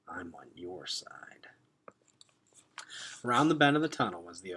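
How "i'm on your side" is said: slow low whisper; whispered reassurance. Acted. Rip Red reassuring the stunned group, slow whisper. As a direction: soothe them in a hush.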